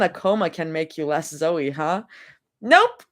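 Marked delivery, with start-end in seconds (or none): 2.6-3.1 high, loud laughing nope. Acted. Teased girl answering with a high laugh.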